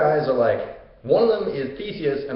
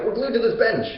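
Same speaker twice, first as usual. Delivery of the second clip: high, plaintive complaint; Theseus; 8 semitones higher than usual. Retold or imitated. Theseus tells Hercules about the bench, plaintive. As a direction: explain the predicament in a raised mock-helpless voice. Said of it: "Theseus is like".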